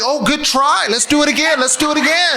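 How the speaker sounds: high, encouraging parent voice. Acted.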